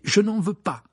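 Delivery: loud, high, firm refusal; decided. Acted. Harry to George about the gold, decided. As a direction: refuse it with determination. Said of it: "d'un ton décidé".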